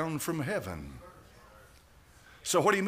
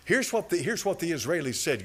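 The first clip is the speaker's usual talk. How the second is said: ordinary delivery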